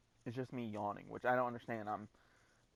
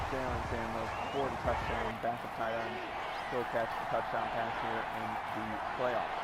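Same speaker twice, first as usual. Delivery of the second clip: lively, louder sports call; play-by-play commentary; 4 dB louder than usual. Acted. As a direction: call the action like a broadcaster. Live play-by-play of own game.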